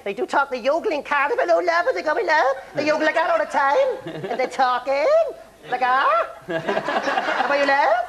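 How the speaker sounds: high, yodelling Cardiff accent. Shown as a demonstration. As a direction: exaggerate Cardiff speech, pitched high like yodelling. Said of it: "they're more sort of Cardiff".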